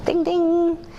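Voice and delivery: high, bright bell-like ding ding; a playful ding of a bell as an idea lands. Retold or imitated. Light bulb goes on.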